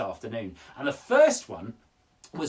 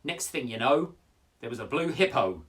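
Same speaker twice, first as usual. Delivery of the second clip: lively, rising read-aloud with building screams; expressive poem reading; 8 semitones higher, 8 dB louder than usual. Acted. Presenter reading a child's poem to kids, building to screaming. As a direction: read the poem with growing drama. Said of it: "It goes like this".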